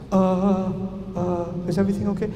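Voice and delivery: soft, hesitant worried question; mock nervous student, very soft.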